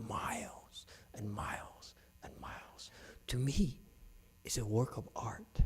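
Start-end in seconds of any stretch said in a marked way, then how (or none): 0.1-2.9 fading hush. Real, not acted. Describing the drum's sound carrying for miles, fading hush. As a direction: fade away into a near-whisper.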